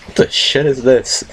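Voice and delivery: mock bewildered; confused. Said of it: "I'm like".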